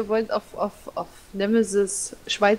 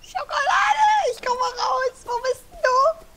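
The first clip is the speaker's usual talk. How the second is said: shrieking high and slow with joy; high childlike squeal; slower than usual. Pretend. Childlike cry for chocolate.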